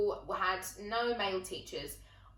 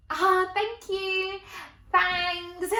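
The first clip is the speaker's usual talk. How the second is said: slow, higher, timid mock gratitude; shy student thanking a lecturer, much higher and drawn out; awkward nervous thanks; 9 semitones higher, 5 dB louder, much slower than usual. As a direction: thank him sheepishly, unsure what just happened. Awkward reply to lecturer retold.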